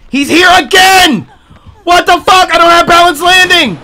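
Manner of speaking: loud, high panicked yell; panicked shouting. Real, not acted. Killer returns, panicked yelling.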